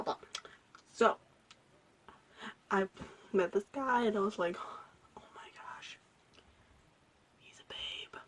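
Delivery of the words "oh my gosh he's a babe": put-on breathless whisper about a cute boy; gushing whisper. Spotting her crush, she whispers to herself. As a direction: hush it like a secret crush.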